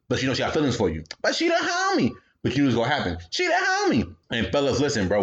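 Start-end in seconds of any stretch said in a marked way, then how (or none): 1.2-2.1 very high defensive whine; whiny excuse. Retold or imitated. Excuse repeated. 3.3-4.1 very high defensive whine; whiny excuse. Retold or imitated. Excuse repeated.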